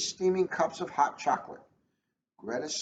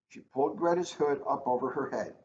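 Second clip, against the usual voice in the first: ordinary delivery, nothing marked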